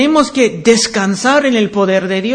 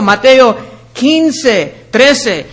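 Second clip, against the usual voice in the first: normal talking voice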